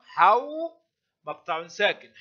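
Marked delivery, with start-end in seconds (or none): 0.0-0.7 high, drawn-out حو as one syllable; teacher modelling the closed syllable حو; this is how. Shown as a demonstration. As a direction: glide "haw" upward on حو. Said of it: "هكذا تنطق".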